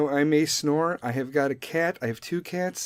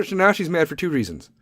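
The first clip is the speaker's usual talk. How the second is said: usual speaking voice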